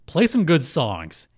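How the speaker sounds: ordinary talk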